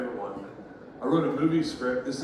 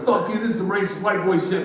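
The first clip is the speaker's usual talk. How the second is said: louder, higher retold outburst; mock angry retort; 5 semitones higher, 4 dB louder than usual. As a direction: snap it as the fed-up customer. Acts out furious woman storming out.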